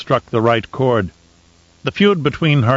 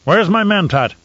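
high, loud demand; demanding call; 8 semitones higher, 3 dB louder than usual. Acted. Baron calling down the halls, demanding. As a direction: bellow for the Mentat.

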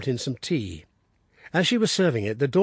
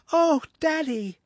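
put-on high, bursting boyish delight; joyful cry; slower than usual. Son greeting his father on arrival, high and overjoyed. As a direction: throw the greeting out in joy. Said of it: "he cried".

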